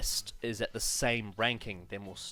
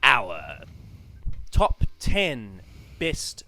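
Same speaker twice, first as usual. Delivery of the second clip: slow announcement; 2 semitones higher, 7 dB louder, much slower than usual. Acted. Announcing the top ten list.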